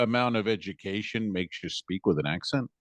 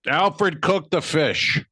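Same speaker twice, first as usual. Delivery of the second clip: drawn-out, higher-pitched dad's holler; father's holler; 5 semitones higher, 3 dB louder, much slower than usual. Retold or imitated. Father calling to his son in the family diner, drawn-out holler. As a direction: stretch it out like dad calling an order. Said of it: "That was my father saying".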